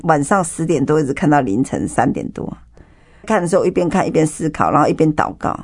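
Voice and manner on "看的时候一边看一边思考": ordinary delivery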